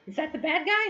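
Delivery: put-on high small child's voice; little girl. Lila in her mother's arms, high child voice. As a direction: ask it like a curious little kid. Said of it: "Lila's like".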